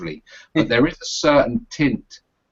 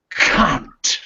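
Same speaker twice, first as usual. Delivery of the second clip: East London accent; 4 semitones higher than usual. Shown as a demonstration. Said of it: "East London vernacular".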